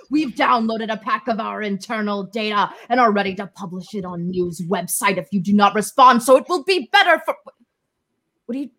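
hammed-up sinister hacker voice; dramatic ransomware reading. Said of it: "That was in the style of the Ziggy ransomware".

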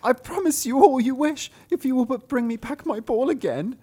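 princess voice. Acted. Princess answering the frog, high girlish squeal.

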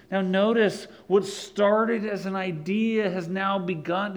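ordinary delivery

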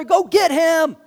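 high loud call over the wind; urgent shout. Retold or imitated. Disciple yelling to another in the storm, urgent shout. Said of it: "They can't even talk to each other".

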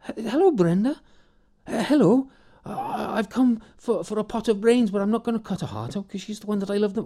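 high, nervous, stumbling greeting; stammering simple man. Acted. Morgan stammers his request to the old woman, high and nervous.

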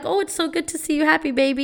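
put-on high, cooing praise to a child; encouraging parent. Shown as a demonstration. Parent to a happy child, high and encouraging.

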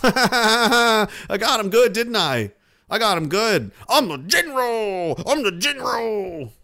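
slow mock of a gloating commander; gloating general. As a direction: crow over the prank like a proud general.

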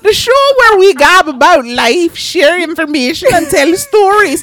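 high, loud mock-British hostess; Missus Doubtfire British accent. Host introduces the show in hammed-up Missus Doubtfire British accent. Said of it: "that was me doing a, like, a Missus Doubtfire".

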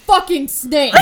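startled shout. Retold or imitated. Trucker spots the snake mid-song and yells. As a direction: blurt it out in alarm. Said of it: "And he's like".

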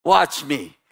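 usual speaking voice

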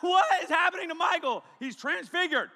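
loud high panicked amazement; astonished shout. Pretend. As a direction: shout it like the room just saw a miracle. Imagined crowd shouting at transfiguration.

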